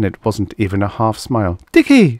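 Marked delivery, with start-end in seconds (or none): 1.7-2.2 high, loud cry of his name. A narrator's reading. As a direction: call out in alarm to the boy. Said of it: "she cried".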